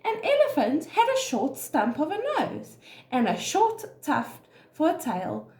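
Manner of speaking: ordinary delivery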